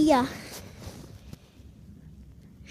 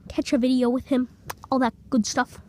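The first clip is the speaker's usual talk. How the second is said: ordinary delivery, nothing marked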